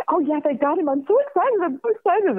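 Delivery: very high, giddy squealing imitation; excited teenager. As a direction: gush like a thrilled teen on the phone. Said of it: "like an excited teenager".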